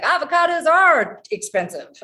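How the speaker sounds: loud, high mock-mom non sequitur; imitating mishearing mom. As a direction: mimic hard-of-hearing mom, loud and way up high. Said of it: "She's like".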